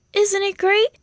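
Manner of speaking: put-on lifted, cheerful young lamb voice; chirpy and pleased with himself; a bright, proud little sheep; high Wilbur voice. Wilbur delighted.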